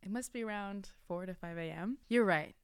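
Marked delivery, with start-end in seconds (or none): none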